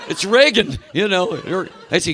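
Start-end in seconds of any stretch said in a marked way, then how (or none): 0.1-0.8 high, loud, laughing gasp of recognition; excited recognition. Retold or imitated. Fan recognising Linda Blair as Reagan, high amazed blurt. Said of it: "he goes".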